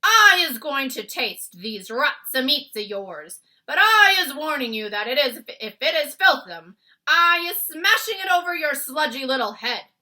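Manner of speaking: the giant warning the BFG he'll smash it; threatening Bloodbottler. A narrator's reading. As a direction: menace him like a hungry brute.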